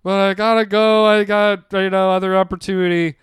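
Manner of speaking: high, loud, halting nervous excuses; acting out a jittery employee quitting; anxious quitting speech. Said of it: "I was all anxiety".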